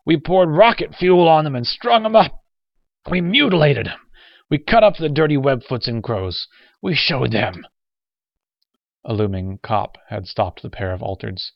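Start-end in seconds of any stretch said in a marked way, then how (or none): 0.0-7.7 high, loud, vengeful rant; angry boasting. Acted. Old soldier boasts to the young one, ranting angrily. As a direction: rant proudly about the old violence.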